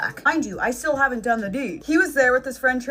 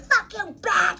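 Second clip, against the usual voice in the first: imitating the furious mom; 7 semitones higher, slower than usual. Mom yelling during arrest.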